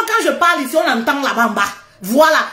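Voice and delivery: ordinary talk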